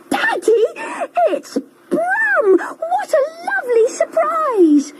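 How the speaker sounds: put-on high, delighted child voice; excited child cry. A narrator's reading. Children greeting Brum with a high excited cry. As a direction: cry out like a thrilled little kid. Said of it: "cried the children".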